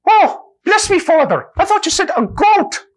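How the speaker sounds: put-on very high, loud squeaky Irish accent; a relieved Irishman blurting it out; high-pitched stage Irish voice. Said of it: "use of the Irish accent".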